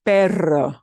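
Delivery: perro with a strong trilled rr; rolled r. Shown as a demonstration. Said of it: "the word for doggy or your dog is perro".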